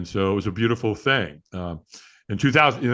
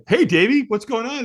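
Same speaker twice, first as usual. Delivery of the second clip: put-on a much higher, louder, sing-song hello to the kid; playful high greeting; 12 semitones higher, 4 dB louder than usual. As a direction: brightly greet the son, acting casual. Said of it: "And I'm like".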